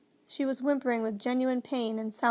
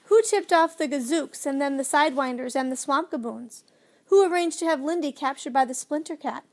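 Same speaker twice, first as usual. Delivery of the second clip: higher, louder indignant outburst; raised voice, heated accusations; angry yell; 4 semitones higher, 5 dB louder than usual. A narrator's reading. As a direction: hurl the blame back angrily. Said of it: "so angry that he yelled".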